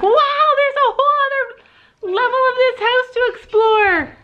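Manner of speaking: high, wide-eyed wonder; excited pet voice. Acted. Owner voices Levi discovering upstairs, high and excited. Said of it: "he's like".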